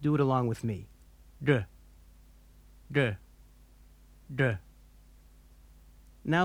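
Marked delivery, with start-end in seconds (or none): 1.4-4.5 "D" with tongue tip curled straight up; retroflex D. Shown as a demonstration. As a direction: say "D" off the hard palate. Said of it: "point the tip of your tongue directly upward".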